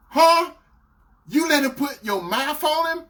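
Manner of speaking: high, incredulous outburst; shocked boyfriend. Retold or imitated. Boyfriend reacting to the confession, shocked. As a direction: blurt it back in disbelief.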